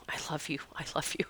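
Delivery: soft words that fade into a breathy whisper; fading whisper. Pretend. Grateful bird thanking her, fading to a whisper. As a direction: let each repeat drop away to nothing.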